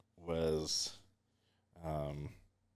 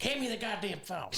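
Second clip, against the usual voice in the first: much higher-pitched imitation of an angry mom; mock an irate mother yelling, pitched way up; pissed-off mock yell. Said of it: "She starts yelling at him".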